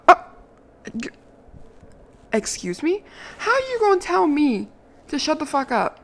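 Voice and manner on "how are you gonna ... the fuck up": usual speaking voice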